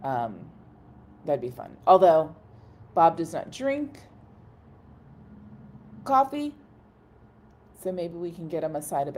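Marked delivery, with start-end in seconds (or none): none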